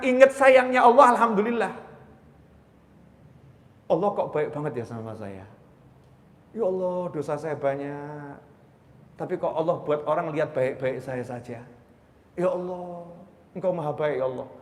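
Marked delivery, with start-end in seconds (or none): 3.9-14.5 soft, lowered voice of wonder; humble, grateful prayer. Acted. Preacher speaking to Allah about his sins, humble prayer. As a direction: confess quietly to God, moved by his kindness.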